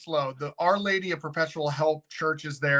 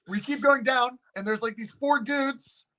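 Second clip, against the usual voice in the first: usual speaking voice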